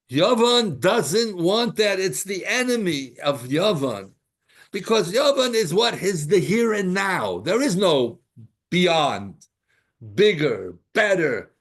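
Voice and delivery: loud, high, insistent preaching; impassioned preaching. Real, not acted. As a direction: drive the teaching home with force.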